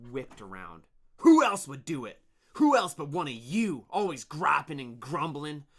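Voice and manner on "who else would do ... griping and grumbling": angry accusation. Acted.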